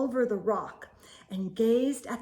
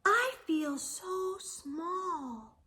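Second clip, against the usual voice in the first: slow, soft, higher little voice of awe; a hushed small snail; 5 semitones higher, 6 dB quieter, much slower than usual. Acted. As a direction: feel tiny beside the world. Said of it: "And she said to the whale".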